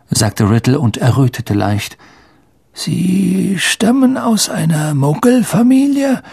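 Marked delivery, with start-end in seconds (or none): 2.7-6.2 put-on high, thin, questioning old man; frail old headmaster. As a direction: enquire like a feeble old wizard. (fragender, gebrechlicher Greis)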